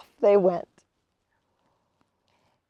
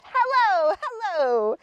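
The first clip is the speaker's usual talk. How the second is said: shouted greeting; 10 semitones higher, slower than usual. A narrator's reading. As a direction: call out gleefully as the disguise drops.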